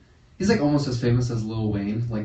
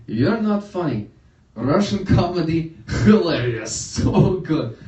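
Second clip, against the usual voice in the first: slow, higher-pitched dad impression; drawn-out, emphatic imitation of dad; opinionated dad; 4 semitones higher, 5 dB louder, slower than usual. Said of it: "he came up to me".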